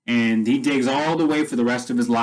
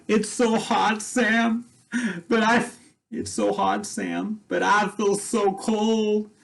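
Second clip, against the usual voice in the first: much higher far-off voice, dazed and faint; delirious from the heat; 9 semitones higher, slower than usual. Retold or imitated. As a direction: voice a woman hallucinating in the desert sun, high and dreamy. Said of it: "and then she's like".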